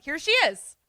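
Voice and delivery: high, loud welcome; hammed-up relieved welcome; flustered greeting. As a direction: announce her return too eagerly. Group greets returning host, acted.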